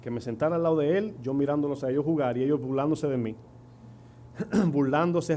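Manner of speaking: ordinary delivery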